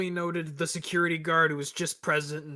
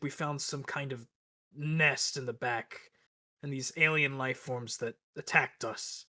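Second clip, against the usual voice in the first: ordinary delivery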